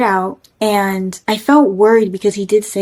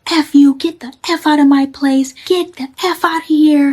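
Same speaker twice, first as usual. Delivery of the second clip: high mock yell retelling him cursing out the medics; retold cursing shout; 6 semitones higher than usual. Drunk man cursing at the ambulance crew, high retold yell. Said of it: "He's like".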